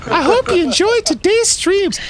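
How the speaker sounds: very high, slow falsetto lady; sweet put-on woman's voice, drawn out slowly; [name]'s female voice. Streamer signs off to viewers in a high slow lady voice. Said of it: "The female voice is [name]'s".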